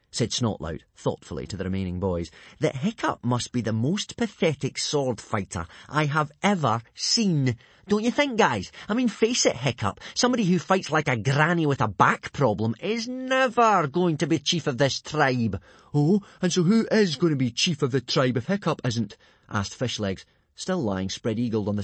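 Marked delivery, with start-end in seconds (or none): 2.6-15.6 put-on higher, louder, swaggering boy; mock your rival in front of the gang; scornful bully. Plays Snotlout mocking his rival. 15.9-19.2 high, loud, put-on boy's voice; indignant questioning; challenging boy. Plays Fishlegs challenging the bully.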